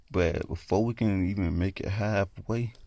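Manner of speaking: ordinary delivery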